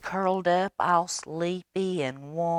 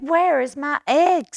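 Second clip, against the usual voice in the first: higher, louder startled bunny; bunny's voice; 12 semitones higher, 10 dB louder than usual. Acted. The bunny waking up, alarmed and looking for his egg. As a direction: cry out as the just-woken bunny. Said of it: "He said".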